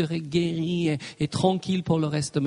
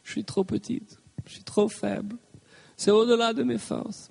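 a hint of a small defeated voice, higher; mock self-pity; 4 semitones higher than usual. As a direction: whine lightly that you're too weak. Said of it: "vous aurez envie ... de dire".

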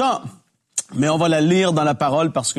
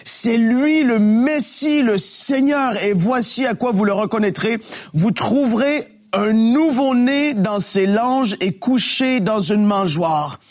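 dramatic scripture reading; 5 semitones higher than usual. Acted. Expressive Bible reading aloud.

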